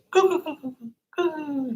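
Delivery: very high coo sounds, each one falling; imitating a turkey's cooing; turkey noise. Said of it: "turkey noise".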